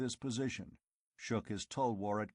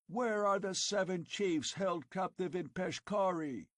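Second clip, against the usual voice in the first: demanding Conan; 8 semitones higher than usual. Acted. Conan calling down to the mob, demanding answers.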